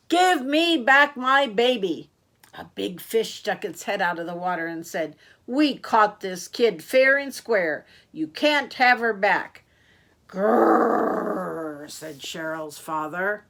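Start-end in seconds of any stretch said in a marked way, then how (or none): 0.1-2.1 high, loud, drawn-out demand; father's yell. A narrator's reading. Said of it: "Cheryl's father yelled down the hole". 5.5-9.6 loud, firm refusal from a fish; defiant big fish. Acted. Big fish defies the father. 10.3-11.9 long, loud rolled growl; frustrated growl. Acted.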